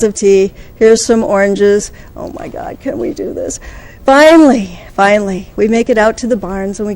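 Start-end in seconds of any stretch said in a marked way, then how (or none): 2.2-3.6 breathy exasperated whisper under the breath; a hushed, weary aside. Retold or imitated. Exasperated aside during endless tea. 4.0-4.8 relieved outburst. Retold or imitated. Relief after long delay.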